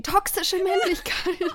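high, loud, slow yell across the club; shouted slogan. Retold or imitated. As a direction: belt it out over the music. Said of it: "hab so geschrien".

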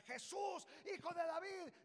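loud, high cry. Acted. Blind man crying to Jesus, loud call. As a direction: call out across the crowd. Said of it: "comienza a gritar".

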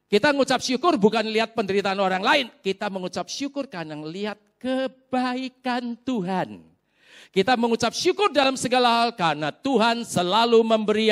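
loud, high, urgent sermon delivery; emphatic preaching. Real, not acted. Preaches at full force. (berkhotbah tegas dan lantang)

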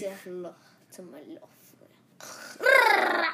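Scarface impression. Kid repeating the movie line, ending in a yell. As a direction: whisper it, then blast the last word. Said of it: "you just wanna do the sound effects".

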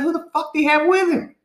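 high, incredulous outburst at a friend; retelling an argument about the best Lakers player, exasperated high retort. Retold or imitated. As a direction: throw the question back at him, fed up. Retold heated basketball argument.

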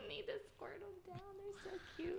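high cooing over the plants; gushing cutesy voice. Real, not acted. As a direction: squeal about how cute they are. Gushing over her succulents.

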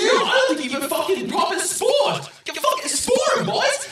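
mock furious rant. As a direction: rant at him, egging the boys on. Player rants at the mushroom NPC.

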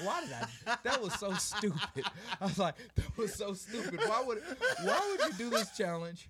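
high, laughing self-scolding; laughing at himself. Retold or imitated. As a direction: scold yourself through laughter.

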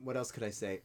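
usual speaking voice

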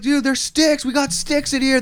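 loud, high frantic protest; panicked yelling back. Retold or imitated. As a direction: shout it back at armed police. Kids protesting to armed police.

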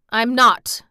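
emphatic refusal; decided. A narrator's reading. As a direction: say it with total decision. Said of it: "said it with such decision".

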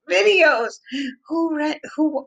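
"Videos!" dilontarkan tinggi dan keras dengan heran; incredulous exclamation. Real, not acted. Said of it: "who goes out and rents these videos".

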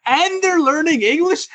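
wide-eyed astonished repeat; incredulous. Real, not acted. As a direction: throw the words back in disbelief. Repeating her words in disbelief.

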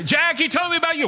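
fast, high-pitched excited call; hollering. Retold or imitated. Woman running through town, hollering to Jack. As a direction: tell Jack the news in a rush.

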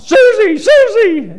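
high, loud holler down a hallway; hollering Susie. Retold or imitated. Inmate hollering down the asylum hall. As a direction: call out a lost love's name.

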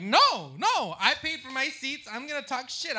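loud, high defiant protest; defiant yell. Retold or imitated. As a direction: insist you paid for the seats. Arguing back at mom loudly.

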